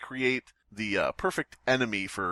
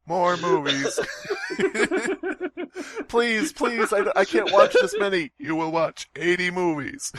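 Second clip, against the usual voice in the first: laughing; 10 semitones higher than usual. Acted. Acted forced movie watching.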